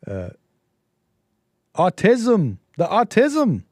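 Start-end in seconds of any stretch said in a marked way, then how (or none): 1.8-3.6 Rodney Dangerfield impression. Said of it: "If I were Rodney Dangerfield, that's how I'd say that".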